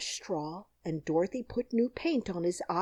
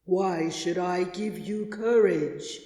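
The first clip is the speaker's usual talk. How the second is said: slow, louder, far-off demand; 5 dB louder, slower than usual. A narrator's reading. Oz questioning the lion's request, slow and demanding. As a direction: draw out the question like a stern power. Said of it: "demanded Oz".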